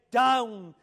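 "Down." on its own, loud and falling; stress on Down. Shown as a demonstration. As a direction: slam "Down." down as a warning. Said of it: "Mark that word".

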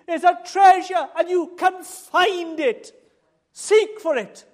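sermon building to a loud cry; full-force preaching. Acted. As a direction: proclaim it loudly to the whole church.

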